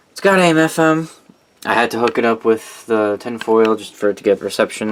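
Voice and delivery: ordinary talk, nothing marked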